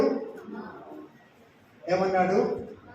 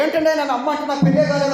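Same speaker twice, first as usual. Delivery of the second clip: quick, lightly raised indignant retort; indignant young woman; 7 semitones higher, 5 dB louder, much faster than usual. Retold or imitated. Preacher imitating a woman offended at being called mother. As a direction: rattle it off fast, offended. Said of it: "ఆమె సాహసించి".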